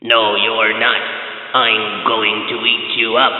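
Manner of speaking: low, slow troll growl; slow and low like a troll under a bridge; deep troll voice. A narrator's reading. Troll threatens goat.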